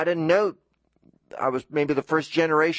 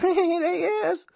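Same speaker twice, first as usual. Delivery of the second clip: put-on giggling little girl. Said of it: "my mother giggling".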